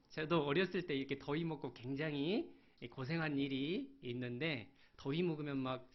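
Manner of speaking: ordinary talk, nothing marked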